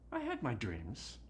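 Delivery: normal talking voice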